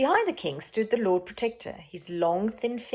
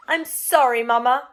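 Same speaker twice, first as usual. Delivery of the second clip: put-on boy's apology; 5 semitones higher, 9 dB louder than usual. Boy apologises to mother.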